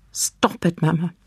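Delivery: usual speaking voice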